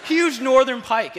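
high, very loud, slow proclamation; emphatic big-fish announcement; excited reveal. Real, not acted. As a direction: boom it out, stretching every word.

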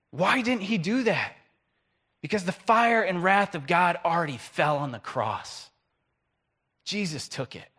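ordinary talk

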